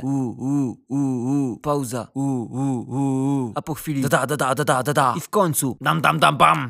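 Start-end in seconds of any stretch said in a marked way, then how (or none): 0.0-1.6 low "oo" like a toy train; imitated booing hum. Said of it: "robić odgłosy takiego buczenia. Trochę jak ciuchcia". 2.1-3.5 low "oo" like a toy train; imitated booing hum. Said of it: "robić odgłosy takiego buczenia". 4.0-5.1 low, rhythmic "da-da" drumming; voiced drum pattern; imitated drum roll. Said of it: "trochę jak werble". 5.8-6.7 punchy mouth-drum hits, louder at the end; building drum flourish; imitated drum finale. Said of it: "trochę jak werble".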